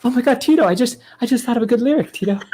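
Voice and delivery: very high, excited pop star voice. Acted. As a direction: share the discovery in a high put-on voice. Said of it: "He's going".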